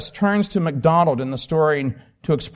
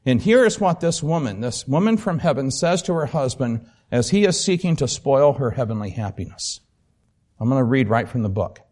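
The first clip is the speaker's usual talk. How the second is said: normal talking voice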